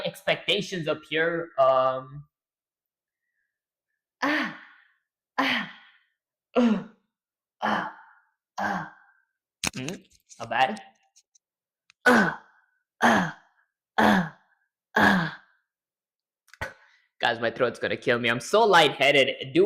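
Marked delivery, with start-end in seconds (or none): 4.2-8.9 short high pained grunts for a game hit; quick higher-pitched hurt cries. Shown as a demonstration. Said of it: "I wanna get a hit sound too". 12.0-15.4 short, higher, louder pained grunts; sharp game damage cries; pained hit grunts. Shown as a demonstration. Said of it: "I wanna get a hit sound too".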